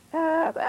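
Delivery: high squawking gull call; seagull cry. Retold or imitated.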